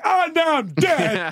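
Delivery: high, slow mock wail; mock despair. Host imagining his kid comforting him, high and slow.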